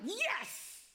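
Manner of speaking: a mock cheer, "Yes!"; excited yell. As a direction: son cheering as he comes out of the baptism water, excited. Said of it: "Mark came out of the water like".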